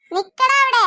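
angry shout. Acted. Mother chases son.

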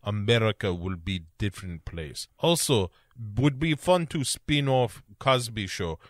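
put-on movie villain in a thick Russian accent; rambling plans in a put-on Russian accent; Rostov, Russian accent. Villain watching his trucks take off, mock Russian accent. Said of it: "and he says".

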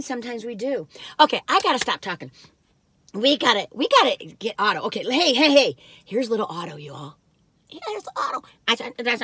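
usual speaking voice

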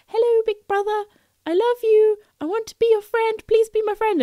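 high, sweet pleading little-brother voice; voicing the horse Duke. Horse Duke begging big brother Casper for friendship, high sweet voice. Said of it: "seemed like he was like".

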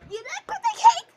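high, loud excited squeal. Acted. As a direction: squeal it at the kids. Teacher voice to kids, squealing.

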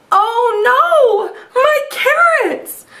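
high, slow wail, half laughing; Rabbit's hammed-up high wail; crying out. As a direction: wail for the lost carrots. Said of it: "cried Rabbit".

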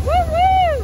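high whooping cheer; excited cheer. Real, not acted. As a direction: whoop it out with joy. Cheering on speeding boat.

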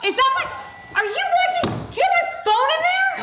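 shocked it's her phone; alarmed realization. Acted. Mom realizes kid has her phone.

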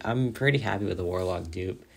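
ordinary talk, nothing marked

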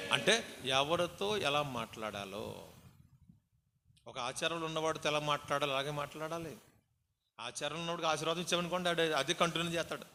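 normal talking voice

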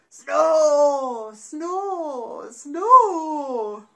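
big exaggerated snores, loud; loud snoring. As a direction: snore it out like a sleeping bear. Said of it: "started to snore".

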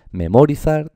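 memorizar stressed on MO, high and loud; wrong second-syllable stress. Shown as a demonstration.

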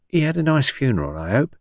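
high-starting, inquisitive Cockney woman; old Cockney patient. Acted. Patient asks visitor about funeral, inquisitive Cockney. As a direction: ask hopefully in a Cockney old-lady voice.

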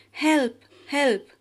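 trapped geese crying for help; frightened cry. Acted. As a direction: call out in panic, caught in the net. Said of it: "cried the geese".

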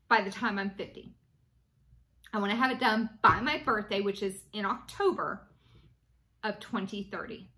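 ordinary delivery

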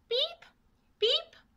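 very high, loud chirping pip pip; chick peeping. A narrator's reading. Newly hatched chicks peeping at the world, high chirps. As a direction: peep like newborn chicks. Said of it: "I piccoli cinguettarono".

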